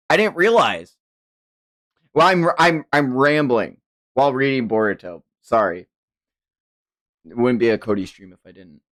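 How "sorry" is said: ordinary delivery, nothing marked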